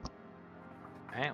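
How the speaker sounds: ordinary delivery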